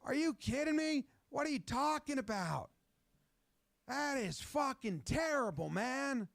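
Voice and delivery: voiced quarterback cursing out the refs; hammed-up furious rant. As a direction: rage at the ref, drawing the words out. Voiced Mahomes raging.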